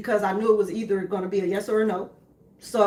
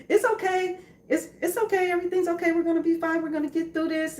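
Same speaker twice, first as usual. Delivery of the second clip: higher, gentle reassuring voice; calm reassurance; 8 semitones higher than usual. Retold or imitated. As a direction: soothe the family, it's all fine. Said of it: "Like, I'm real, um, calm about it".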